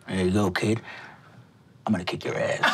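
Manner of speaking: put-on low, half-whispered old man's threat; low whispery threat to the kid; gruff Winston. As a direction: growl it low and breathy like a grumpy old man. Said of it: "Winston gets you a set of dominoes and looks".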